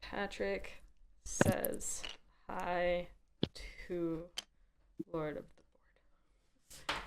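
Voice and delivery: soft whispered message; whispering. Real, not acted. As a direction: hush it, almost unvoiced. Passing on a message, hushed.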